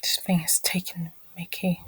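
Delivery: real resigned whisper. Real, not acted. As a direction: whisper it, giving up. Resigned aside about the glitch.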